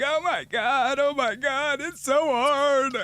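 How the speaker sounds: high-pitched whiny fake sobbing; put-on weepy high voice; mock crying wife. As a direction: imitate a tearful wife wailing, drawn out at the end. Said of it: "she was crying".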